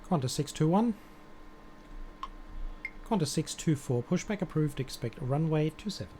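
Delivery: normal talking voice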